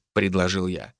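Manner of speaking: ordinary delivery, nothing marked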